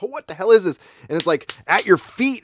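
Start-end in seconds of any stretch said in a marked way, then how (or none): 0.0-0.8 higher and louder, startled; alarmed mock reaction. Describing the fish attacking his feet, alarmed yelp. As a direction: recoil at something weird in the water. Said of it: "You're like".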